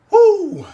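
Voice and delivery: loud joyful whoop, way up high; excited whoop. Real, not acted. Fan whooping at a huge kickoff return, loud and thrilled. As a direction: cheer the big return with a whoop. Big kick return, cheering.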